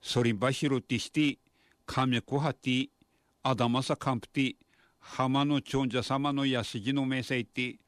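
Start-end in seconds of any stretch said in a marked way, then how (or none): none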